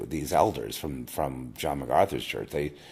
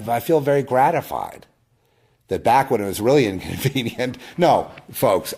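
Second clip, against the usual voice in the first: gratified outburst; 6 semitones higher, 6 dB louder than usual. Real, not acted. Excited aside to viewers.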